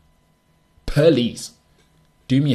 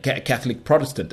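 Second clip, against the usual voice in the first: usual speaking voice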